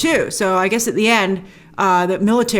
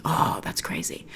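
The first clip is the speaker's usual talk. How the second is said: whispered awe; 6 semitones lower, 11 dB quieter than usual. Real, not acted. Reacting quietly to trailer shot.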